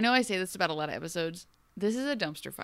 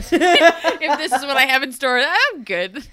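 laughing it off, louder; 6 semitones higher, 11 dB louder, slower than usual. Real, not acted. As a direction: brush it off through laughter. Repeats while laughing.

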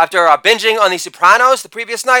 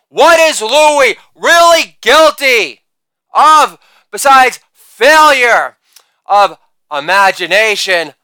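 usual speaking voice

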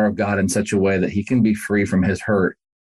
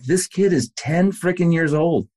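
amazed; 7 semitones higher than usual. Retold or imitated. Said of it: "And I'm like".

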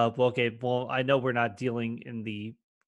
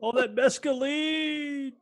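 stoner; 12 semitones higher, much slower than usual. Retold or imitated. Stoner bit.

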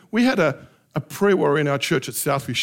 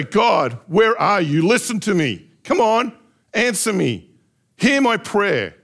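demanding prayer; 4 semitones higher, 5 dB louder, slower than usual. Acted. Pastor voices raw demanding prayer.

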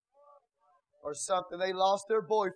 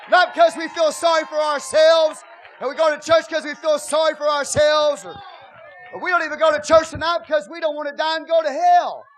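shouted, higher, much louder sermon; full-force preaching; 8 semitones higher, 14 dB louder than usual. Acted. Pastor exhorting the congregation, shouted full-force preaching. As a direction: preach it hard to the church.

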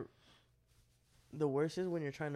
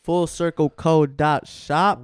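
very loud, slow plug; announcer-style shout; 18 dB louder, slower than usual. Real, not acted. Co-host plugging the sale website, slow loud shout. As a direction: belt out each word of the address slowly. Co-host hammers home the web address.

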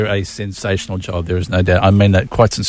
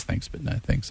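soft breathy whisper; whispered refusal; 15 dB quieter than usual. Retold or imitated. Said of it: "she goes".

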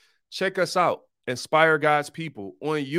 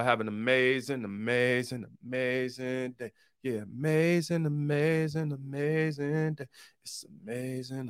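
softer and softer on each amazing, like a voice fading out; fading sign-off; 6 dB quieter than usual. Pretend. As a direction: let every amazing die away like an echo. Looping sign-off that will not end.

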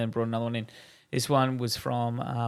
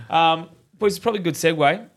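usual speaking voice

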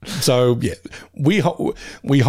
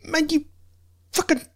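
high, strained mock yell without volume; exasperated scolding, pitched way up; retold yelling; 3 dB quieter than usual. Dog-sitter telling off the dogs, mock yelling. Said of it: "I didn't mean to yell at you".

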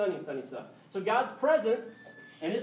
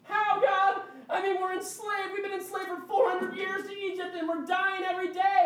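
desperate people; 11 semitones higher than usual. Acted. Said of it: "enslaved people crying out to God".

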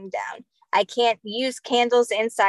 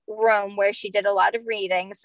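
usual speaking voice

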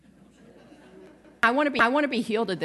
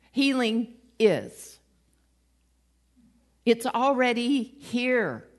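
slow, deliberate preaching emphasis; emphatic slow declaration; 4 semitones higher, 4 dB louder, slower than usual. Real, not acted. Preacher driving home the point to the congregation, slow and emphatic. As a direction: land each word slowly.

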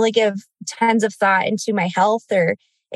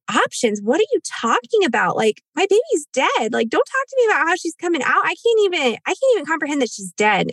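higher, upset retort to the nurse; distraught, raised-pitch outburst at the nurse; anguished protest; 7 semitones higher than usual. Retold or imitated. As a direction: push back in disbelief and grief.

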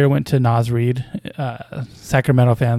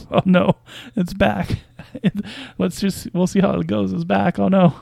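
higher, laughing oh no; amused dismay; 6 semitones higher, 3 dB quieter than usual. Real, not acted. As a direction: groan at it, half laughing. Something annoying comes back mid-recording.

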